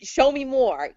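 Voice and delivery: high, loud eager plea; excited. Retold or imitated. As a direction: beg for it with excitement. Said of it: "I'm so excited".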